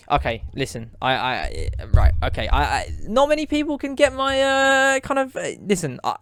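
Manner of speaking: flustered false starts, leaping high midway; exasperated stammering. Acted. Cook struggling to explain herself to Tony, flustered and exasperated. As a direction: stumble over the words in exasperation.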